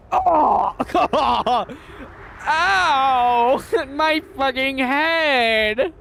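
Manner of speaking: high yelps breaking into laughter; laughing yelp. Real, not acted. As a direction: cry out "ow" through laughing, drawn out. Yelps after a crash in game.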